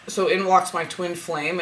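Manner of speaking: usual speaking voice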